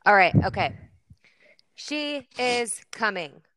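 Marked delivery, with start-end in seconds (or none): 1.8-3.4 dramatic announcement. Acted.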